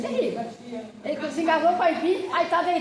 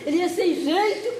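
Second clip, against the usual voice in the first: ordinary talk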